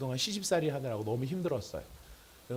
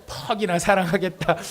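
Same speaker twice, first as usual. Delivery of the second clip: louder, higher mocking disbelief, falling end; sarcastic scoff; 5 semitones higher, 7 dB louder than usual. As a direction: jeer at a spouse's promise of love. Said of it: "이런 비웃음을 가질 수 있다는". (목소리를 높여 비꼬는 말투)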